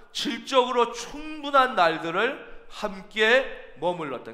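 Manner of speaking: normal talking voice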